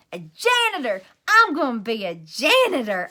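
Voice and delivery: loud, bursting with pride; proud holler. A narrator's reading. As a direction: holler it to the whole class. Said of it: "I hollered out".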